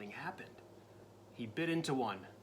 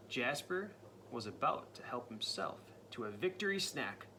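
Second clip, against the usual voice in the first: usual speaking voice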